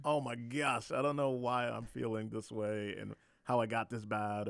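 acted-out exasperated wail; overwhelmed outburst. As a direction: blurt it out as if overwhelmed. Acts out being overwhelmed too late.

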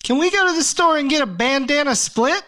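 put-on high whiny kid voice, begging mom; kid asking mom. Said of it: "you're like".

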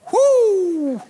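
long, high whoo; preacher's whoop. Real, not acted. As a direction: let out a high, drawn-out whoop. Preacher whoops mid-sermon.

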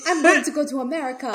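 lightly put-on African accent, amused; mock African accent. Co-host imagines the imposter announcing his trip, in a mock African accent. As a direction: imitate him with a makeshift African accent. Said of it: "I gave him an African accent".

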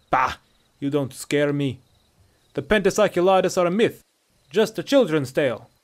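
loud, scornful Nord; husky Nordic bravado; husky Nordic captive. A narrator's reading. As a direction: scoff defiantly at the threat. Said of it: "This voice was husky and distinctly Nordic".

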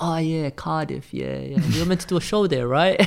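a hint of a boxing team member faking recall; mock recalling voice. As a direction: imitate someone half-remembering, then hurrying on. Mimicking team recalling Wales.

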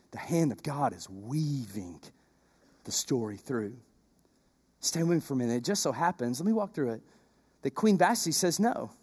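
low, soft sermon narration; hushed storytelling. Acted. Preacher telling the congregation the story, hushed. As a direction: draw the congregation in quietly.

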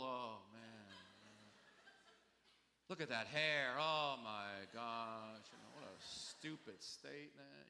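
drawn-out, exasperated grumble; grumbling with attitude. Acted. New Yorker judging people under their breath, slow grumbling. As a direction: complain slowly under your breath, eye-roll in the voice. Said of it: "we grumble a lot, we complain, we have attitude".

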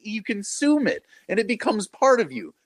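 excited emphasis. Real, not acted. Podcast guest marvels that bread and cup are material, excited and high-pitched. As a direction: voice leaping high in amazement.

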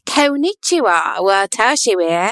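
exaggerated American-accented Japanese; thick American accent put on the Japanese on purpose; hammed-up American accent on Japanese. [name] shows off fake-accented Japanese, hammed-up American accent. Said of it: "my extreme accented foreign languages".

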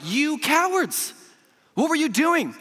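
high, slow rebuke of the cowards; rebuking. Acted. Preacher voicing Jesus scolding the frightened disciples, high and loud. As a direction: scold them like a stern master.

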